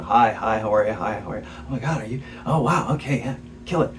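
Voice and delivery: light imitation of polite greetings, lilting up then dropping soft; mock small talk with acquaintances; retold greetings. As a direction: toss out quick, airy hellos. Acts out fancy people's chance greetings.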